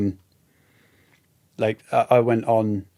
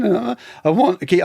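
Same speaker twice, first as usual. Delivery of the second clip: raised, breaking shout; 6 semitones higher than usual. Retold or imitated. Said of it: "I'm shouting".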